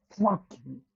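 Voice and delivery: angry shout. Real, not acted. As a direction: spit it out at the screen.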